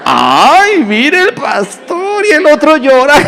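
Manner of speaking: high, hammed-up busybody voice; gossiping church brother. Gossipy brother spotting the pastor, mock-scandalized. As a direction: mimic a scandalized gossip, breaking into laughter. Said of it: "un hermano que le gusta murmurar".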